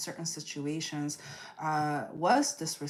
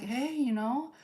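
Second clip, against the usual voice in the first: higher, sweet coaxing invitation; playfully enthusiastic friend urging a taste; loving encouragement; 6 semitones higher than usual. Retold or imitated. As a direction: coax them gently into trying it. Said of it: "came in all lovingly".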